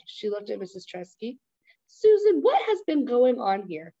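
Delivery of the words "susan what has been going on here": much higher, louder cry for answers; distraught mother calling her grown daughter; upset demand. Acted.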